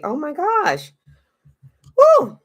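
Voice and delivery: high, loud excited woo; excited squeal. Real, not acted. Excited about the story.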